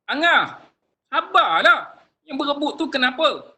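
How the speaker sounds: loud, high-pitched exasperated telling-off; angry scolding. Shown as a demonstration. Teacher acting a parent scolding squabbling Angah, angry. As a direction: scold the child while demanding patience. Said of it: "Kita marah tapi menggunakan perkataan sabar".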